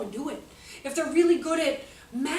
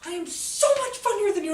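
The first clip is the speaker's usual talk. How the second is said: fired-up protest; 12 semitones higher, 10 dB louder than usual. Retold or imitated. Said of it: "I get all fired up".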